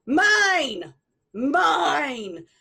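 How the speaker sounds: the voice behind calling loudly as the narrator leaves; loud echoing claim. A narrator's reading. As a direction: call it out loud from behind, twice. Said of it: "I could hear the voice behind me going".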